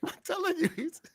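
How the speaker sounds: laughing. Real, not acted. Laughing disbelief in argument.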